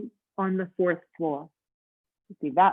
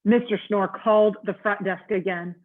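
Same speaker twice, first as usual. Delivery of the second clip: usual speaking voice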